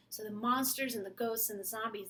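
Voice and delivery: ordinary delivery, nothing marked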